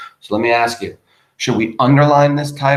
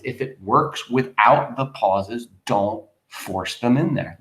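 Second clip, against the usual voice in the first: usual speaking voice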